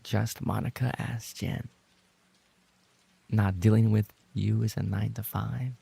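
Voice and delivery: ordinary delivery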